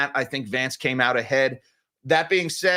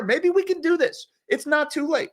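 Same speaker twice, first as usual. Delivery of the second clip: hopeful high voice; 11 semitones higher than usual. Acted. Wishful joke about swapping candidates.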